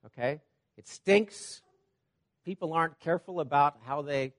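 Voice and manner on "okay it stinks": ordinary delivery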